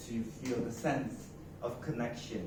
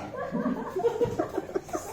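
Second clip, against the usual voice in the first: high burst of laughter. Real, not acted. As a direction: break into laughter. Laughs after the demo.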